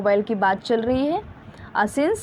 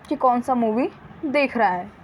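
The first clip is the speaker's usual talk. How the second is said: ordinary talk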